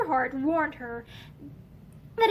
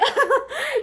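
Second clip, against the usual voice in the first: savage laugh; 10 semitones higher, 4 dB louder than usual. A narrator's reading. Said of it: "Heather laughed savagely".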